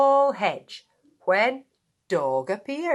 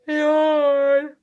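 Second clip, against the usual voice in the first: loud high acted yawn; big yawn; 7 semitones higher, 8 dB louder than usual. Spider yawns.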